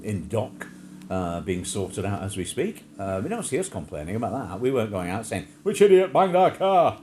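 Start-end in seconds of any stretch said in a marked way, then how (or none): 5.6-7.0 loud, slow indignant shout; hammed-up outraged cry; mock outraged yell. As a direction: bellow it like an angry neighbour. Mock indignant complaint quoted.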